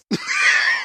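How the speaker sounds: high shrieking laugh; burst of laughter. Real, not acted. Laughs at the punchline.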